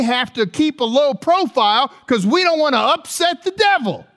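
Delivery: rally the church with fire; full-force preaching. Acted.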